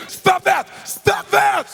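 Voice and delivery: high, loud yelled protest, said twice; outraged shout. Retold or imitated. Chairman yelling at the therapist to stop, loud and high. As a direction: bark it like someone who keeps getting hit.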